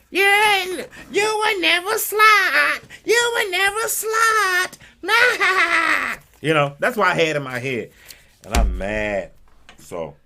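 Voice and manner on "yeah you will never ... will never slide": very high, slow, hammed-up crying wail; mock sobbing. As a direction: wail it like a cartoon breakdown. Mock-crying despair in his head.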